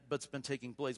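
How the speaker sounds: ordinary delivery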